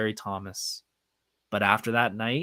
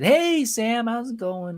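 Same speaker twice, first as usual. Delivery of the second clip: high, loud opening hail, easing off; excited greeting; 11 semitones higher, 4 dB louder, slower than usual. Retold or imitated. Fan walking up to greet a celebrity, excited high-pitched greeting. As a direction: call out a big friendly hello.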